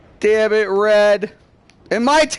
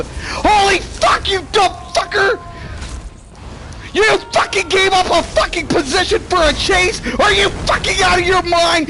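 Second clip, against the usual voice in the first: raging shout; 7 semitones higher than usual. Real, not acted. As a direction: scream it at your useless team. Raging at teammates during a match.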